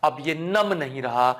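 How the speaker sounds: usual speaking voice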